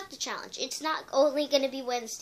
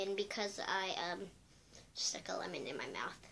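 whisper; 7 semitones lower, 16 dB quieter than usual. Real, not acted. Trailing confession.

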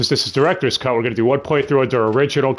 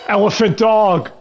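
high, loud and drawn out; excited exclamation; 11 semitones higher, 5 dB louder than usual. Real, not acted. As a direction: blurt it out in delighted disbelief. Reacting to a monster on screen.

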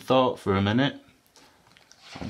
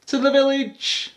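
high, loud little voice; baby elephant; 14 semitones higher, 5 dB louder than usual. A narrator's reading. Baby elephant answering mum where she'll go, high and eager. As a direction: announce it like an eager youngster. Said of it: "said the baby elephant".

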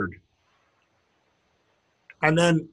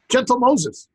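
higher, exclaimed in mock surprise; surprised oath; 6 semitones higher, slower than usual. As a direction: exclaim it as a quaint curse. Acting out Danish surprise oath.

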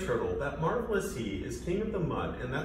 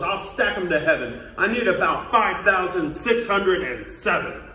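loud, indignant royal ranting; snorting outrage; 8 dB louder than usual. Acted. Turtle king refuses to be outranked, snorting outrage. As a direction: declare it loudly like an affronted king. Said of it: "snorted Yertle".

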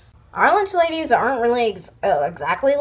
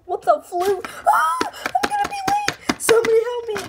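panicked; 11 semitones higher than usual. Acted. Panicked kid stuck at locker.

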